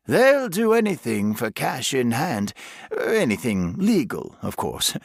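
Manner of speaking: lightly voiced chatty vicar; friendly vicar voice. A narrator's reading. Vicar character voiced by narrator.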